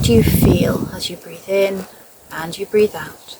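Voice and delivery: measured meditation voice, leaving space after each phrase; guided meditation. Acted. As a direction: guide a listener gently into noticing the breath and body. Leads a guided body scan.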